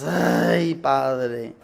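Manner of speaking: imitating a preacher's sighing prayer; Eugenio Macías. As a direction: sigh it out in imitation, falling away. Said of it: "Y habla así el hermano Eugenio". (imitando un lamento de predicador)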